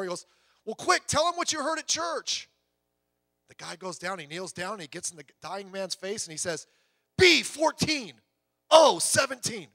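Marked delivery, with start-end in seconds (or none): 0.6-2.5 high, raised hurry-up push; urgent urging. Acted. Urging man in joke. 7.2-9.7 loud, high shouted bingo numbers; bingo caller shout. Acted. Punchline shouted bingo call.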